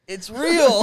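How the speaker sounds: loud, high mock amazement; amazed exclamation. Telling friends how they reacted to the bartender, loud high-pitched amazement. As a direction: retell it as a big excited outburst.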